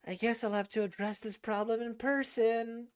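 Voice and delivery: ordinary talk, nothing marked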